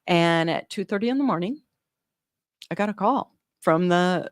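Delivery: ordinary talk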